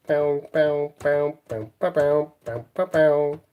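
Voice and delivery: rhythmic mouth-made bow sounds; playful "bow" noises. Pretend. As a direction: pop out little bows in a playful rhythm. Playfully imitating noises while working.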